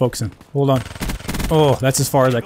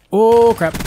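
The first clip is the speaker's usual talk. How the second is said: startled; 8 semitones higher, 5 dB louder than usual. Real, not acted. Sudden enemy encounter.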